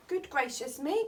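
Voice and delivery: plain reading voice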